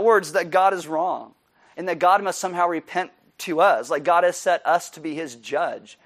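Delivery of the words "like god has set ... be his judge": usual speaking voice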